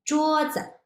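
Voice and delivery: high 桌 followed by a short, toneless 子 in 桌子; lighter tone on 子. Shown as a demonstration. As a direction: hold 桌 high and clip 子 in 桌子. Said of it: "instead we will say".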